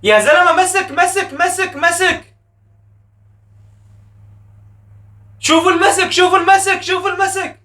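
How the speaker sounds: indignant protest. Real, not acted. Protesting a foul on screen.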